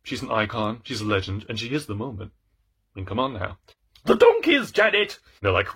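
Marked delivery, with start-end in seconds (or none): 4.0-5.2 very high, loud cry; mock alarmed shout. Aunt Betsy calls to Janet about donkeys, alarmed shout. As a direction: holler it in hammed-up alarm.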